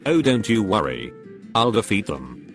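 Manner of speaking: ordinary talk, nothing marked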